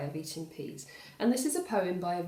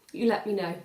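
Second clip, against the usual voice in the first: ordinary delivery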